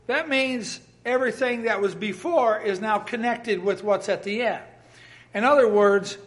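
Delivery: usual speaking voice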